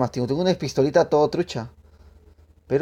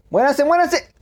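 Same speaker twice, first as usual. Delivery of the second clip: high, loud battle shriek; berserk rage; 13 semitones higher, 7 dB louder, slower than usual. Real, not acted. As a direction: scream it like a madman. Charging into enemies in game.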